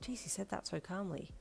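soft aside to yourself; hushed private remark; inner thought. Acted. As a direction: think it under your breath. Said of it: "you thought".

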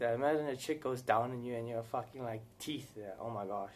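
ordinary talk

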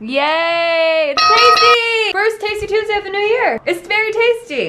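high, loud delighted squeal; excited. Real, not acted. Tasting the stew, thrilled.

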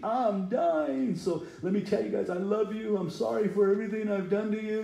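high, pleading mock deathbed farewell; melodramatic dying man. Preacher mocking his own deathbed speech to his sons. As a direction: ham up a dying man's goodbye. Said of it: "and you think".

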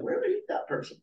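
high, breathy, bewildered whisper to yourself; puzzled whisper. Retold or imitated. Finding a forgotten business card months later, puzzled whisper. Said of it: "you're like".

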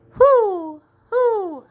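high, loud hooting like an owl. Acted. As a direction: hoot like an owl.

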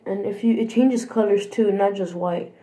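ordinary talk, nothing marked

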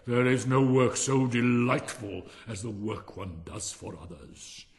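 loud, slow pronouncement, dropping away at the end; sanctimonious miller. Acted. Miller praises unpaid work to Hans, loud and sanctimonious. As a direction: proclaim it grandly and slowly.

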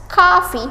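coffee said as kafi, high and clear; model pronunciation. Shown as a demonstration. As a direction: say coffee as KAH-fee with a bright falling tune. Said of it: "kita baca coffee". (coffee diucapkan kafi, nada tinggi lalu turun)

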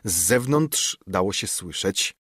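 ordinary delivery, nothing marked

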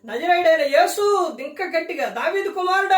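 loud pleading call; blind man's cry. Retold or imitated. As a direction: cry out to Jesus for mercy. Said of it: "అని కేకలు పెట్టిండు". (గట్టిగా కేకలు)

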